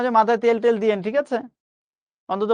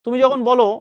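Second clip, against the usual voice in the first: ordinary talk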